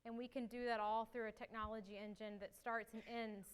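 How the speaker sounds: ordinary talk, nothing marked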